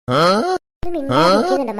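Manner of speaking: fed-up shout. Acted. Scared friend snapping at the joker, slow loud shout. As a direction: drag out ഒന്ന് മിണ്ടാതിരിക്കുന്നുണ്ടോ loud and low. അവൻ്റെ കളിഞ്ഞ comedy.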